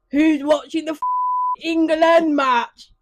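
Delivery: loud, high English football-lad shout, slowed on the match; Gammon accent. Shown as a demonstration. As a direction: bellow it like a pub hooligan at the game. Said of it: "the Gammon accent".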